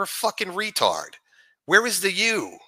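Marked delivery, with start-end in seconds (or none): none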